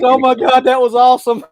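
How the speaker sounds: high, loud imitation of laughing through words; imitated helpless laughing. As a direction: mimic a friend laughing his butt off. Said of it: "just laughing his butt off".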